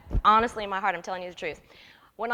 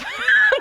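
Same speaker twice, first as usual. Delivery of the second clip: loud high laugh; 12 dB louder than usual. Real, not acted. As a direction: burst of shrieking laughter. Laughing at being snubbed.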